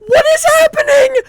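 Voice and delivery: very high, loud disbelief; shocked shouting. Real, not acted. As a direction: shriek in total shock. Shocked at glitch.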